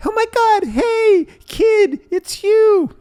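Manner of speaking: very high, loud joyful cry; overjoyed dad. Retold or imitated. Forgetful dad welcoming his son back, overjoyed. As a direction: greet him like a long-lost son.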